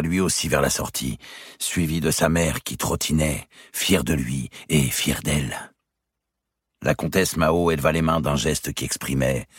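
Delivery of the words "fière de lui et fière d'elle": plain reading voice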